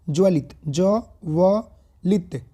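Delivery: ज्वलित broken into ज, व, लित with pauses; spelling out syllables. Shown as a demonstration. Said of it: "लित हम लोग ऐसा लिखते हैं".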